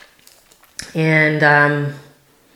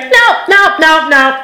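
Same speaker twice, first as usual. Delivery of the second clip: high, louder no, no, no; urgent refusal; 10 semitones higher, 4 dB louder than usual. Retold or imitated. Telling herself this cannot be happening, high and loud. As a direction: push back hard, voice jumping high.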